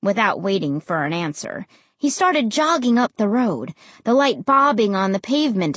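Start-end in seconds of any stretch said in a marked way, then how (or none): none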